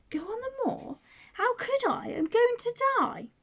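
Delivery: high, startled disbelief; shocked, voice jumping up; sick boy startled by Mary. Acted. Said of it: "as if he was startled".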